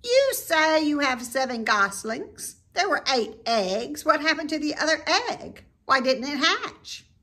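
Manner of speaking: high, loud demanding rat voice; sharp rat voice. A narrator's reading. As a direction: press the question sharply. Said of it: "in a sharp voice".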